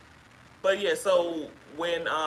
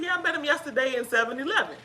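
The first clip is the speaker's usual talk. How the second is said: higher, airy mock caller voice; imitating the caller; 7 semitones higher, faster than usual. Retelling what the unsuspecting caller said, higher voice. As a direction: play her light and unaware, pitched up. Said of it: "She said".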